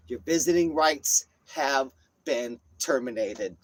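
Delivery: slow, word-by-word pronouncement; mock-official verdict. Man banning girlfriend from visiting, mock-official verdict. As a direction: hand down the ruling slowly, pausing between words.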